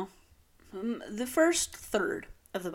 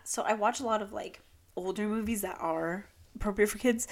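usual speaking voice

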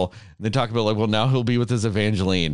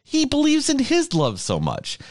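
worked-up rant, much higher pitch; angry; 9 semitones higher than usual. Real, not acted. Fan defending a firefly's love to friends, angry and worked up. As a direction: argue it passionately, voice climbing high. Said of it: "Just so angry still".

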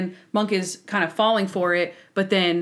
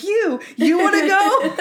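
higher, louder, laughing dare; mock picking a fight; 10 semitones higher, 5 dB louder, slower than usual. Monk, slow and teasing, challenges Stottlemyre as he walks past. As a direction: puff up and dare him, half laughing. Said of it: "he's like".

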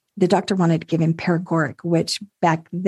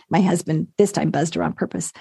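ordinary talk, nothing marked

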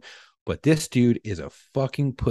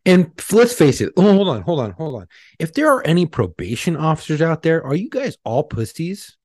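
higher and louder, calling out the listeners; heated, raised voice daring them to answer; riled-up callout; 7 semitones higher, 4 dB louder than usual. Real, not acted. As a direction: rant straight at the probation officers out there. Calling out probation officers on air.

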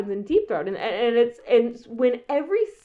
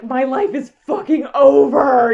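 louder, overwrought mock despair; mock melodramatic wail; 3 semitones higher, 10 dB louder than usual. Imitating Linda crying in the scene, hammed-up. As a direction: ham up the tragic line. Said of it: "when Linda's crying".

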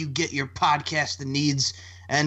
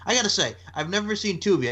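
ordinary talk

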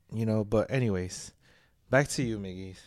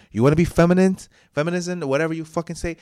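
louder, higher, fired-up rant; heated rant; 5 semitones higher, 7 dB louder than usual. Real, not acted. Ranting to listeners about who pays the bills, loud and heated. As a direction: push back hard on the idea of women as the new man.

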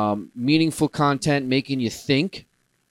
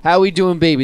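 loud, higher quick catchphrase; 5 semitones higher, 7 dB louder, faster than usual. Pretend. Host repeats his catchphrase to the listeners, loud and quick. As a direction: toss it off punchy. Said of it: "I just love saying that".

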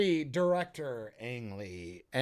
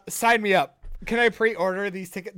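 ordinary delivery

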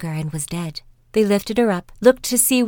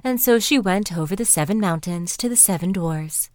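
ordinary delivery

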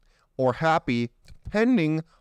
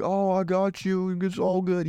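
slow, higher sweet consoling; fake supportive friend; 4 semitones higher, slower than usual. Jealous friend faking comfort after the breakup, slow sweet voice. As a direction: soothe with false sympathy. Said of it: "you're like".

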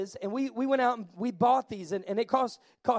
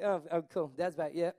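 low, soft and unimpressed, mock shrug; a lightly voiced family that can't be bothered; bored kids; 6 semitones lower, 7 dB quieter than usual. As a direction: play the kids who barely look up when Dad walks in. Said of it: "they be like".

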